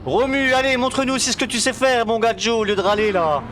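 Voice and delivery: plus aigu, exhortation exaltée; encouragement enthousiaste. Real, not acted. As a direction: encourager le pilote avec élan. Encourage le pilote en direct. (voix de supporter survolté)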